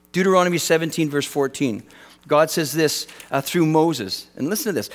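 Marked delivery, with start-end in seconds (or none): none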